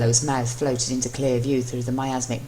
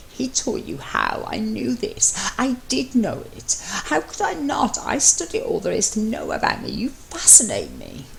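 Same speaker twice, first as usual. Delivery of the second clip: a touch of a character voice; 8 semitones higher than usual. Acted. Voiced character speech in story.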